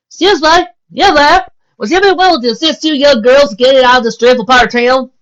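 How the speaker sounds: high, loud genteel plea; prim lady calling out. Acted. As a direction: flag down a car like a lady. Posh lady calling to a car.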